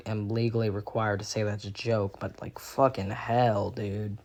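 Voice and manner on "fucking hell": usual speaking voice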